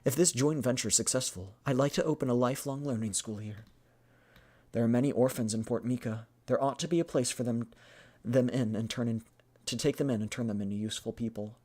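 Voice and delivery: lightly acted kind businesswoman; game character sounding kind; kind-sounding benefactor. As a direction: voice a hopeful, kindly speech about helping orphans. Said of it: "She sounds really kind right now".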